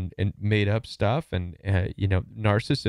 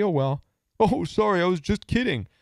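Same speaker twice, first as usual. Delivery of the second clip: higher-pitched, flippant fake apology; mock high schooler; 7 semitones higher than usual. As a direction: brush it off like a teasing high schooler. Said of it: "They act like high schoolers sometimes".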